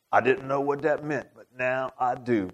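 soft, slurred, understated imitation; slurred stroke speech. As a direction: murmur it in a slurred friend's voice. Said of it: "his speech is really slurred".

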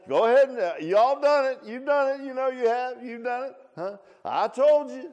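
very high, loud teasing; mock scolding congregation. Preacher teasing congregation in high, loud scolding voice. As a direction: rub it in playfully.

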